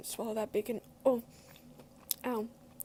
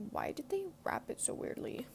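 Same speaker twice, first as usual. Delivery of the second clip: ASMR whisper; 6 semitones higher than usual. Real, not acted. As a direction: whisper it close to the mic. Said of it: "kind of A S M R because of my headphones".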